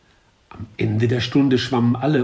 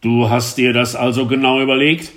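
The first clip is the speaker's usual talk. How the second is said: louder, angry teacher shout; 3 semitones higher, 7 dB louder than usual. A narrator's reading. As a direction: yell at the pupil, exasperated. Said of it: "schrie er zum dritten Male".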